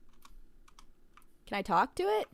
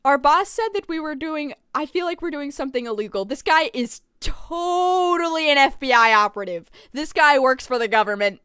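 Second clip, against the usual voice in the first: loud, emphatic insistence; raised, worked-up accusation; agitated suspicion; 4 semitones higher, 10 dB louder than usual. Real, not acted. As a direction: loudly insist on your wild theory. Streamer insists customer is FBI.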